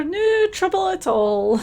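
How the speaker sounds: drawn-out cheery Scottish echo of "No trouble at all"; sing-song Scottish "No trouble at all", slowed down; terrible Scottish accent. Acted. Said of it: "please enjoy my terrible Scottish accent".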